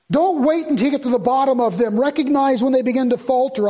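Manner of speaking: forceful sermon exhortation; urgent preaching. Real, not acted. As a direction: press the warning on the congregation.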